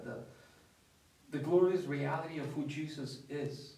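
ordinary delivery

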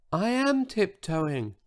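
high, soft, slow protest; tiny voice. A narrator's reading. Huge dinosaur defends himself to George, tiny-voiced. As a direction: answer in a meek little voice. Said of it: "in his tiny voice".

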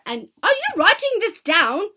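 high, loud question; sharp demand. A narrator's reading. Grand High Witch checking her witches, sharp demand. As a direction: break off and demand it.

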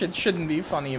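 ordinary talk, nothing marked

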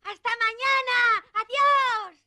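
calling goodbye. Acted. Boy calling goodbye back.

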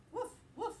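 high, bouncy woof woof; playful dog bark. Acted. Teacher barking for Biscuit to the kids, high and playful. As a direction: bark like a happy puppy.

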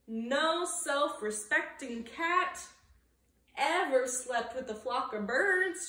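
usual speaking voice

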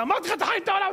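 very high-pitched mock shouting; scolding yell. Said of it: "השם צועק עליו". (צעקת נזיפה מומחזת)

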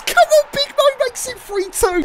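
high, excited commentator shout; excited goal call. Real, not acted.

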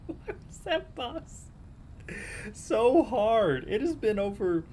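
high, squeaky complaint; exasperated whine. Real, not acted. Gamer venting about a week-long boss, whining. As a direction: whine it high, worn out by the struggle.

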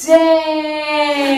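loud, drawn-out exclamation; retold cry of astonishment; amazed outburst. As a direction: stretch it out loud in mock amazement.

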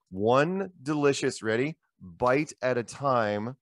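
slow, drawn-out tagline with a teasing pause; slogan read. Shown as a demonstration. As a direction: announce it like a catchphrase. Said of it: "There's a story that goes with this".

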